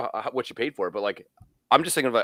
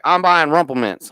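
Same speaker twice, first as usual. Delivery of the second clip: loud, raised put-on accent; bad Beeman accent; 5 semitones higher, 8 dB louder than usual. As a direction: badly imitate a pal's accent, louder and higher. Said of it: "I'm doing a bad Beeman accent".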